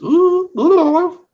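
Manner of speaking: imitating high fluty hoots, loud. Describing the female Sasquatch calls, imitated high fluty hooting. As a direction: sing out high whooping ooh sounds like a creature. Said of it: "doing fluty tone noises".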